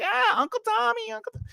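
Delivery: put-on very high, small-child voice; laughing little nephew. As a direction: imitate a little kid who thinks it's a joke. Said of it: "So he's laughing".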